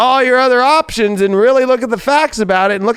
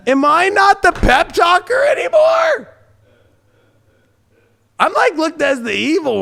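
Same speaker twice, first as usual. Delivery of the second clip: mock indignant; 10 semitones higher than usual. Reader jealous of Craig's pep talks, high mock-indignant wail. Mock hurt at being replaced.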